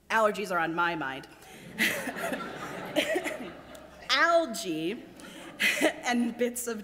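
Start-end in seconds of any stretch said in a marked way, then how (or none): 1.3-4.0 breathless giggle at a slip; real laugh. Real, not acted. Reader laughs at own slip. 5.1-6.0 giggle. Real, not acted. Reader laughs at own slip.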